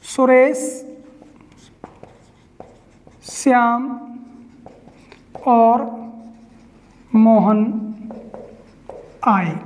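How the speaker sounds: long pause after every word; word-by-word dictation. Shown as a demonstration. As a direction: dictate slowly, one word at a time, dropping on आए. Writing the comma example out slowly.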